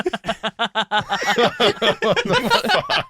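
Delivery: laughing disbelief. Real, not acted.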